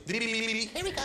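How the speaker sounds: high mock mom calling from the porch; a hint of a mom calling in from the porch; calling kids to dinner. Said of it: "stands on the front porch and goes".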